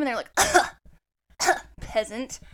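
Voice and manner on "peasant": a hint of a haughty gamer; snooty scoff. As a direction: sneer it like a snob. D&D kids scoffing at her.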